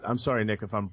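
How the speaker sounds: ordinary talk, nothing marked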